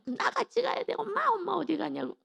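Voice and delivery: high-pitched mock whine; crying child. Small child pleads with departing mother, mock crying. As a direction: whine like a child clinging to mum. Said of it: "울고 막".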